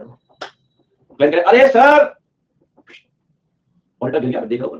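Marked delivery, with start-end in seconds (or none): 1.6-2.1 high, loud drawn-out cry; calling out. Acted. Client slipping near the auditor, high drawn-out yell. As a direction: yell it out, rising high and stretched.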